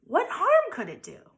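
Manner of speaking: high wheedling pigtail voice; urging pigtail. A narrator's reading. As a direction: wheedle him into it.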